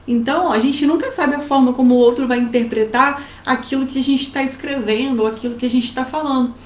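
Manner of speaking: ordinary delivery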